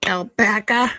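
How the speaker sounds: light Boston accent, questioning; a hint of Boston. Shown as a demonstration. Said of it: "My Boston accent".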